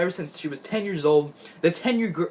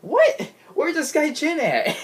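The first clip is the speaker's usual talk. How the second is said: mock shock; 12 semitones higher, slower than usual. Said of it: "I was like".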